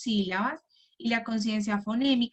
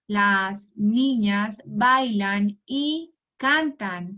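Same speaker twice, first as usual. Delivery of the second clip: very slow and louder, each word set apart; counting on fingers; 4 dB louder, much slower than usual. Shown as a demonstration. Teacher counts five words on her fingers for the child, very slowly. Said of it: "usas tus deditos".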